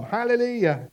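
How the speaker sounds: shouted praise. Acted. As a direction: proclaim it as a mild pulpit shout. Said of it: "heaven, shouting".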